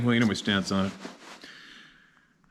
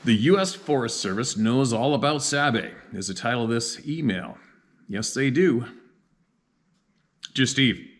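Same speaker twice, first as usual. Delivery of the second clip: letter read in a raised voice; reading a letter aloud; 3 semitones higher, 4 dB louder than usual. Acted. Reads viewer email aloud.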